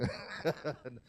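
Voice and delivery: low, short real chuckle. Real, not acted. Preacher reassures congregation about money, chuckling. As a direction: chuckle at your own aside.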